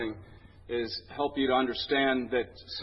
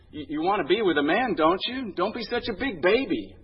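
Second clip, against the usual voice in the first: higher-pitched comic berating; mock scolding; 6 semitones higher, 4 dB louder than usual. Psychiatrist berating patient about men, exasperated.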